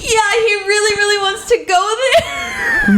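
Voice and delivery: quoting a friend through giggles; laughing quote. Retold or imitated. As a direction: retell the line while cracking up.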